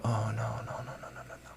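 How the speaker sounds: hushed, trailing string of refusals; quiet dismay. Retold or imitated. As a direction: shrink into a near-whisper of dread. Acting dismay at accent request.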